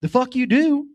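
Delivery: high, loud incredulous snap; mock-offended dad; dad reacting to his son switching teams; mock outrage. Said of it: "I'm just like".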